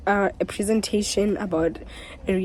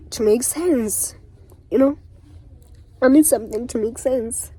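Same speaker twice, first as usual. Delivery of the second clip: pleading; 4 semitones higher, 5 dB louder than usual. Real, not acted. Pleading after sigh.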